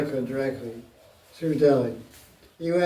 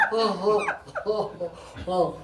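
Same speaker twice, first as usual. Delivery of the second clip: mock laughing oh; 3 semitones higher, 6 dB quieter than usual. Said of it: "he was laughing".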